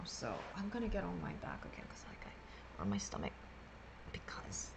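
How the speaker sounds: drowsy whisper, barely voiced; half-asleep whisper. Real, not acted. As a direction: sleepily breathe the words out. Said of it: "half asleep and half awake".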